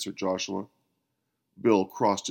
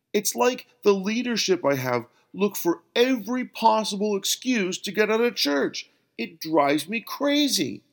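1 exasperated complaint; 11 semitones higher than usual. A narrator's reading.

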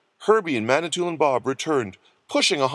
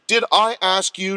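higher, sharp rebuke; demanding; 6 semitones higher, 4 dB louder than usual. A narrator's reading. Nurse cuts off the doctor, demanding. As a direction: put him in his place. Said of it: "demanded the nurse".

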